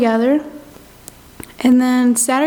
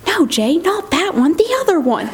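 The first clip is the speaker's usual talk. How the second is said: imitating an exasperated friend, pitched up; higher, insistent mock correction; 6 semitones higher than usual. Retold friend correcting her mistake.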